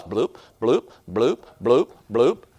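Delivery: low playful bloop noises, one after another; bloop sound effect. Retold or imitated. Preacher imitating sheep dropping off the cliff, playful bloops. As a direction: pop each bloop like something dropping away.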